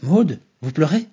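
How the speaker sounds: plus fort et lent, étonné; s'exclamer, inquiet; exclamation surprise. A narrator's reading. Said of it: "se retourna et s'exclama". (Harold s'exclame en voyant Maud pleurer, surpris)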